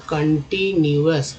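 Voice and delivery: Continuous slowly, syllable by syllable; teacher breaking up continuous slowly; sounding out. Shown as a demonstration. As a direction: stretch continuous into separate parts. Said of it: "कन भी है".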